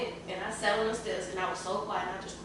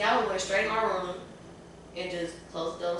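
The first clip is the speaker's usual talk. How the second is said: usual speaking voice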